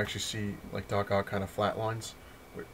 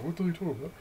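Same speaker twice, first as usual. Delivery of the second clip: higher, puzzled voice of everyone; mock puzzled crowd; 8 semitones higher, much faster than usual. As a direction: play the baffled onlookers. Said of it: "everyone's like".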